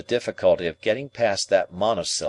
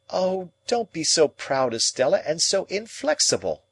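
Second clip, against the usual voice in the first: pleading; 8 semitones higher than usual. A narrator's reading. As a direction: beg her not to be proud. Pip pleading with Estella.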